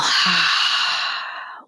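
long, voiceless exhaling sigh; demonstrated meditation sigh on the out-breath; audible sigh. Shown as a demonstration. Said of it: "just sigh on the exhale".

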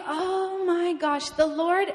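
high, slow "oh my gosh"; retold wonder, drawn-out and high; awestruck. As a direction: stretch it out in amazement. Acts out realisation of coincidence.

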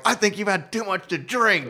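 high, teasing singsong, throwing his words back; mocking payback. Comic waking the drunk old man, mocking high singsong. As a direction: gleefully parrot the old man's own line at him. Turning David's line back on him.

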